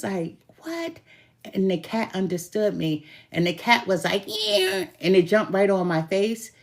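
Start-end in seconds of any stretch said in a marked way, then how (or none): 0.6-1.0 high, tiny cat voice; a cat squeaking back; cat's reply. Retold or imitated. Cat answers her in story. 4.3-4.9 high, tiny cat voice; a cat squeaking back; cat's reply. Retold or imitated. Cat agrees in story.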